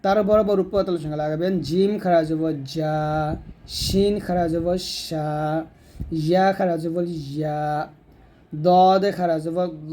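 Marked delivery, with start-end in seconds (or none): 1.7-9.9 letter drill, "জা", "সা", "ইয়া", "দ" each held long; one-alif drawn-out vowel. Shown as a demonstration. Teacher drills mid-tongue letters, drawn-out "জা", "সা", "ইয়া", "দ". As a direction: spell each, then stretch "জা", "সা", "ইয়া", "দ". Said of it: "এক লিফ পরিমাণ টান দিবেন".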